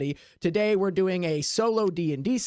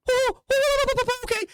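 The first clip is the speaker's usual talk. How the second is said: very high, louder nervous oh, oh; panicked oh-oh; 4 dB louder than usual. Acted. Game master voicing the player, panicking at the wheel. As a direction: squeak out the panic.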